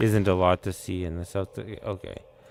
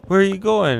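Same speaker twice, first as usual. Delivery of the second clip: friendly Japanese guy; 9 semitones higher, 4 dB louder than usual. Retold or imitated. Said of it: "was like".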